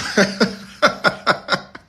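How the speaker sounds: loud airy burst of laughter; loud real laugh; big real laugh. Real, not acted. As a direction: laugh hard and open. Laughs at own joke.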